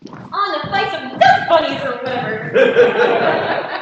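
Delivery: loud, slow line through laughter; ending the scene laughing. Real, not acted. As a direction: giggle out the exit line.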